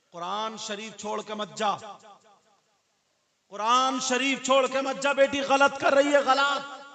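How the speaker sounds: very high, very loud wailing plea; a mother begging her daughter to stay, wailing very high and loud; mother's anguished cry. Retold or imitated. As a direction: wail at her not to leave the Quran.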